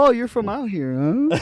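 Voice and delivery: high-pitched mock small talk; feigned friendliness. Prank-call target chatting up a scammer, feigned friendliness. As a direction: lift it into a put-on chummy question.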